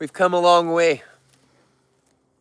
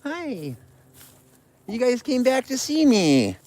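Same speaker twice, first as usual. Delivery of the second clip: sweet greeting; 6 semitones higher than usual. Real, not acted. Greeting bears returning.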